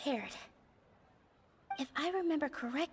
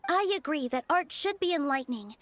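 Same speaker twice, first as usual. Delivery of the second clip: louder, fervent debate voice; passionate rebuttal; 4 semitones higher, 6 dB louder than usual. Acted. Nilou answering the scholar in debate, loud and impassioned. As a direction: stand your ground and push back hard.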